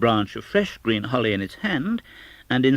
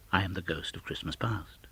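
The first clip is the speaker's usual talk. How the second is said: whispered spirit; 3 semitones lower, 9 dB quieter than usual. Acted. Spirit names itself.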